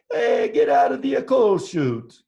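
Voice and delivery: loud, high Italian workman yelling; Italian accent. Acted. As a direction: holler it out slowly in a cartoon Italian accent. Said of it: "I did my Italian accent".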